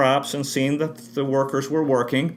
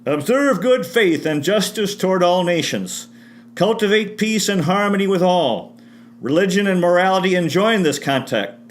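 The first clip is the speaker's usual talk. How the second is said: raised, solemn reading of Washington's address; formal quotation reading; 4 semitones higher, 3 dB louder than usual. Shown as a demonstration. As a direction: read it like a proclamation, a touch higher and louder.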